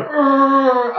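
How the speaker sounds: very high loud arr; corpse moan. Retold or imitated. Friend imitating the corpse moaning. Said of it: "He's going".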